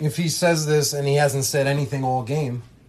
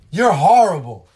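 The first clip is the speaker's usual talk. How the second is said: a loud, higher insult shouted at the ref; mock yell; 6 semitones higher, 12 dB louder than usual. Coach shouting an insult at the ref, loud mock yell. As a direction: throw the insult at the official.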